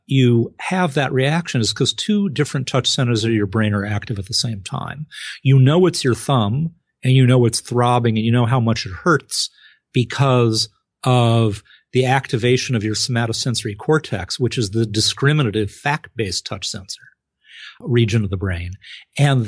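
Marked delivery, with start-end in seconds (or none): none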